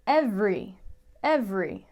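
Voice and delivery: every said as EV-ree, middle vowel gone; two beats only, ev-ree; two-syllable every. Shown as a demonstration. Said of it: "We're going to say".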